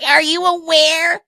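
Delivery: high, slow, prissy mock reporter voice. Mimicking the reporter grilling Keira, high and slow. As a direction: sneer it out like a preachy interviewer. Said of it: "that's basically what this reporter sounded like".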